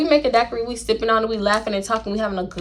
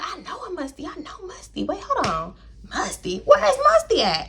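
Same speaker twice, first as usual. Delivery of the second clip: mock puzzled inner voice; 9 semitones higher, 4 dB quieter than usual. Puzzling over the name.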